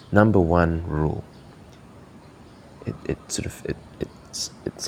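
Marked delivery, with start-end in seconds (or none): 2.9-4.9 whisper. Real, not acted. Trails into a whisper.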